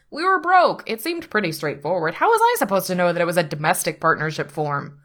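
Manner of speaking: protesting. A narrator's reading. Pete excuses the wrong paperwork to his attorney, protesting. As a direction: plead your case with rising exasperation. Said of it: "Pete protests".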